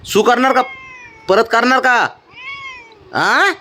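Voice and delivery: high, insistent repeated question; mock-stern questioning. Real, not acted. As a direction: keep pushing him for an answer. Father repeats the scolding question.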